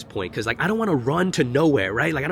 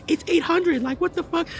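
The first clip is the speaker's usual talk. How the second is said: squeaky high mock woman's voice. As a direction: mimic her, pitched way up. Retold woman's angry reply.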